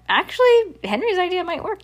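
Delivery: high, lilting suggestion; voiced dad. A narrator's reading. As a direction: pipe up with a tentative idea. Dad's reply voiced high.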